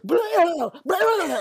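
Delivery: loud, high mock word-vomit; babbling blah noise. Woman imitating her own unstoppable rambling, babbling. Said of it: "could not stop saying words".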